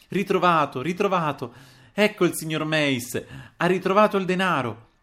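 high, loud jubilant cries; exultant announcement. A narrator's reading. Landlady bursts in on the quarrelling family, exultant. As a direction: proclaim the good news to the whole room. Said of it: "ad annunziare esultante".

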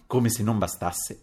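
ordinary delivery, nothing marked

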